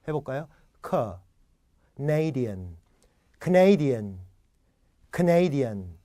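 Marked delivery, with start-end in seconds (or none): 2.0-6.0 Canadian as "kuh-NEI-dian", the Can almost swallowed; weak first syllable. Shown as a demonstration. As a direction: shrink Can to "k", make NEI big and clear. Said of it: "앞에 Can은 약하고 불분명하게 읽어야".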